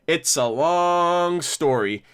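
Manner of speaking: slow, with "long" held and stretched; drawn-out "long". Retold or imitated. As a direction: stretch "long" out in a retold reply. Retold reply, word stretched.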